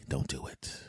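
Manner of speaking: hushed self-warning whisper. Retold or imitated. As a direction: whisper it to yourself, urgent.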